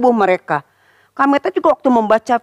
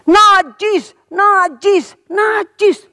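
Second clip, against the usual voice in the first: high, loud warning cry; calling out unclean; 8 semitones higher, 3 dB louder, much slower than usual. Acted. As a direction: call it out so people keep away. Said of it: "Jadi seorang sakit kusta itu". (seruan peringatan keras dan tinggi)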